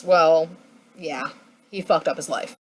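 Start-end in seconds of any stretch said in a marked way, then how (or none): none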